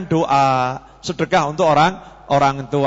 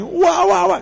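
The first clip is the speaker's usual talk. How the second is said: high mock exasperated wah wah; exasperated nagging; 13 semitones higher than usual. Tired parent complaining about child, mock exasperation. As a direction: whine in fake frustration.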